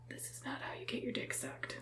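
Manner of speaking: breathy whispered aside; whispering. Retold or imitated. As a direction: whisper it to yourself. Inner thought on smelly date.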